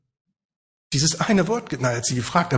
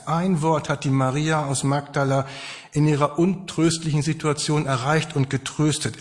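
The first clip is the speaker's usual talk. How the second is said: usual speaking voice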